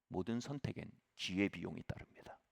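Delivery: ordinary delivery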